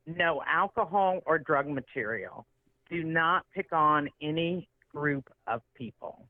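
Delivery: slow, deliberate mock rule-reading; reciting rules. Comic reciting the club's guidelines to her, slow and deliberate. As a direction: spell out each restriction slowly. Retelling the directors' strict guidelines.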